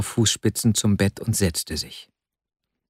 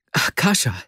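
higher-pitched exclamation of the name; excited call; 6 semitones higher than usual. A narrator's reading. Vasja jumps up and calls to his friend, excited cry. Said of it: "rief Vasja".

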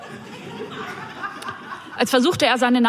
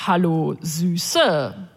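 lower, smarmy come-on with a greasy smile; lightly voiced creep oozing charm; oily pickup line; 5 semitones lower, much slower than usual. Man at the bus stop chatting up the narrator, oily and lower. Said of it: "mit einem öligen Lächeln".

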